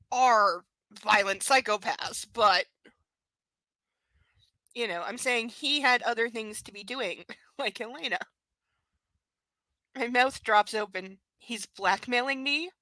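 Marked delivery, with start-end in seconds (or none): none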